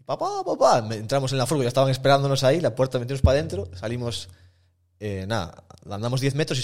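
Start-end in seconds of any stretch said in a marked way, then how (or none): 0.0-0.8 higher and louder mock cry of "papá"; girl screaming for dad. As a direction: squeal it like the panicked girl. Girl yelling for her father. (imitando el grito agudo de la chica llamando a su padre)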